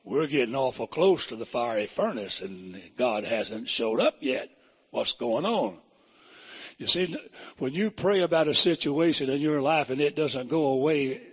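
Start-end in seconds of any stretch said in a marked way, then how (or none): 0.0-5.8 a worried man nearing the furnace, lightly voiced; uneasy Meshach. Meshach answers Abednego near the furnace, lower and uneasy. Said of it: "Meshach might have said".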